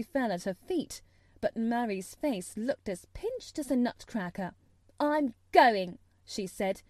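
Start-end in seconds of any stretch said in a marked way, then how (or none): 5.0-6.0 loud, higher, defiant; angry announcement. A narrator's reading. Mary leaving after the pillow throw, angry. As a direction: announce it with finality.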